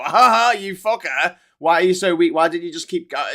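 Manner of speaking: a hammed-up bully laughing at the sick; mock jeering taunt. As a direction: jeer at them like a bully. Imagined mocking of cancer patient.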